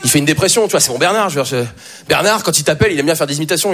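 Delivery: usual speaking voice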